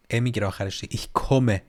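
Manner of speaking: usual speaking voice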